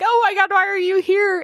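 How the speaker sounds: very high mock-overwhelmed voice; astonished reunion. Recapper voicing the woman finding the squad here, astonished. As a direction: imitate squealed disbelief at seeing friends.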